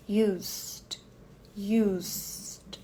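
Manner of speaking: used com S longo direto pro D; "Used" sem E, S longo e D; E mudo. Shown as a demonstration. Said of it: "esse E aqui é mudo". ("Used" com E mudo e S alongado)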